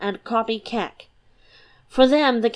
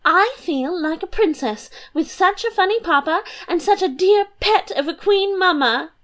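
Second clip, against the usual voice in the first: put-on high, bubbly girlish voice; a light, giddy young princess; 9 semitones higher than usual. Princess teasing her papa and mamma, high and bubbly.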